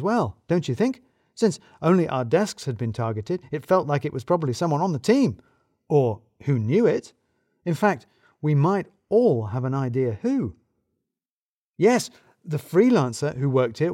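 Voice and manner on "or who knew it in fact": ordinary delivery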